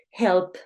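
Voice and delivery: "help" with a firm P at the end; hard final P. Shown as a demonstration. Teacher explains strong endings, "help" with a hard P. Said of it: "esa P final fue como dura".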